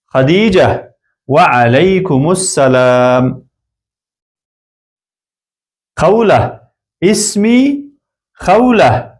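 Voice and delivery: slow, careful lesson recitation of an Arabic dialogue; slow model reading. Shown as a demonstration. Teacher reading a model dialogue to learners, slow and careful. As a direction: read each line slowly for students to copy.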